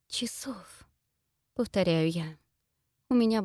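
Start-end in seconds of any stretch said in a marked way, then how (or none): 0.1-0.9 dazed unvoiced whisper. Real, not acted. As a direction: breathe the word in shock. Dazed heroine echoes the word.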